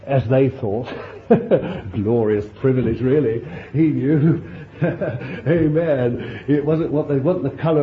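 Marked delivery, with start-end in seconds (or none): 1.0-1.9 short breathy joyful laugh; delighted laugh. Real, not acted. Delighted at the irony. 1.9-6.4 laughing joy. Real, not acted.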